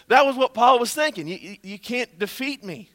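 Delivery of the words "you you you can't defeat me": ordinary talk, nothing marked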